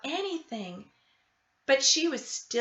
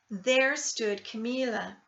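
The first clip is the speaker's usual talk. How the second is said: usual speaking voice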